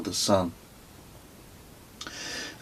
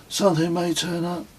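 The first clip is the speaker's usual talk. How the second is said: put-on lightly higher boy's voice; a hint of a young son, hopeful; Kez's voice; 9 semitones higher, 3 dB quieter than usual. A narrator's reading. Son reassuring his dad, lightly voiced boy. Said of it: "replied Kez".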